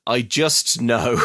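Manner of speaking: slow, drawn-out knowing tone; slow mock mystic. Host mocking Luther's vague answer, slow deadpan. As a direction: lean on just, deadpan slow.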